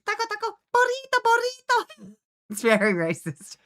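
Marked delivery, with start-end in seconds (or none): 0.0-2.1 put-on much higher, singsong Spanish-accented chant; mock Spanish diva chanting food words; Luna's Spanish voice. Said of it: "It's like".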